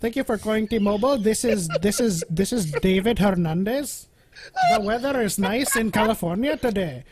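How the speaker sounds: T-Mobile agent, put-on Indian accent. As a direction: play a chirpy helpline worker with an Indian accent.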